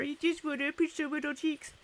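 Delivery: high-pitched cooing; playful high baby-talk voice; cutesy baby talk. Pretend. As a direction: coo it as if to a baby. Cooing over a viewer's little cheeks.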